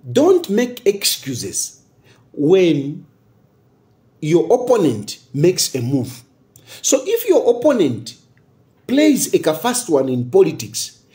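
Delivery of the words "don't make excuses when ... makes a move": slow and weighty, each word spaced; slow proverb quote. Retold or imitated. Quoting a political saying to listeners, slow and deliberate. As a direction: lay down the saying slowly like a proverb. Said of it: "and they say".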